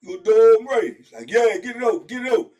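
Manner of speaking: loud high holler; hollered call. Retold or imitated. Guard hollering across the block, loud high. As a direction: call up to the tier. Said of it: "somebody holler up there".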